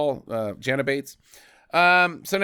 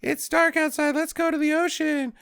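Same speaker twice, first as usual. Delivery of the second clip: put-on much higher, bubbly party girl; giddy bimbo voice; 11 semitones higher than usual. Booby ladies on the beach cheering, high giddy airhead voice. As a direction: squeal it out like an airhead beach babe.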